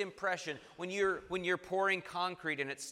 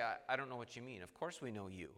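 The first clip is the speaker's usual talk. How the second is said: softer, quick confused reply; puzzled Philip; 5 semitones lower, 9 dB quieter, faster than usual. Retold or imitated. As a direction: rush it out, baffled and hushed. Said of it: "Philip would say".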